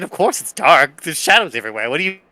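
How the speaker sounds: normal talking voice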